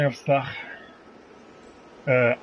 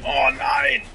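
dismayed; 11 semitones higher, 3 dB louder than usual. Real, not acted. Losing a target in game.

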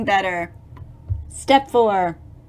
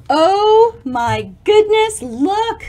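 louder, higher, slow oh my goodness; excited discovery; 7 semitones higher, 6 dB louder, slower than usual. Real, not acted. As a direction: point at something amazing.

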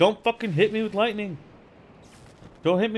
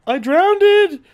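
much higher and louder shout; frustrated shout; 11 semitones higher, 11 dB louder, slower than usual. Real, not acted. Streamer drowning his character, yelling high. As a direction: yell it out in disbelief.

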